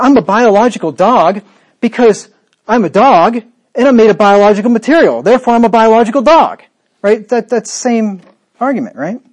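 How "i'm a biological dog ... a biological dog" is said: louder, higher put-on voice; deluded fool. Preacher mocking a deluded fool in a loud put-on voice. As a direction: ham up a man insisting he is a dog. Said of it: "some deluded fool who thinks".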